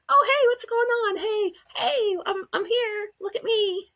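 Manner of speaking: very high, excited mock dog voice; happy dog. Dog greeting owner at the door, happy high voice. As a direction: voice the oblivious dog, squeaky-high and cheerful. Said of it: "all happy".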